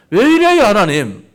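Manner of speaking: high, loud cry of complaint; anguished outcry. Pretend. Pastor crying out to God in complaint, high and loud. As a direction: throw the question up to God in pain.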